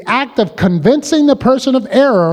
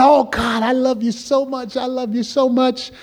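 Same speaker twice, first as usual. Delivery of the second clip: a hint of a swooning worshipper, higher and much softer; mock pious gushing; 5 semitones higher, 4 dB quieter than usual. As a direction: sigh it out like a showy believer. Preacher imitates a pious pretender.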